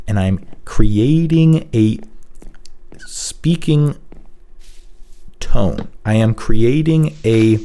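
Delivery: slow "creating a", then long pauses around "speaking", "tone", "emphasis"; deliberate pauses. Shown as a demonstration. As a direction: hold back "intrigue" with a long pause after "I am creating". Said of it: "because I'm pausing, I'm comfortable with pausing".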